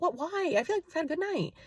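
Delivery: high, soft mock disbelief from the date; shocked what. Date protesting she turned him down, high mock shock. His shocked reply, retold.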